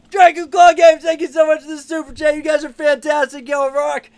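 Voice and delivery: loud, high, muffled shout of thanks from inside the pile; excited shout. Real, not acted. Streamer buried in plush pile thanks a donor, loud muffled shout. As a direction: yell out the thank-you from under the plushies.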